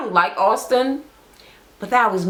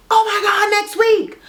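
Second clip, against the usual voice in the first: excited squeal; 12 semitones higher than usual. Real, not acted. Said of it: "I cannot wait".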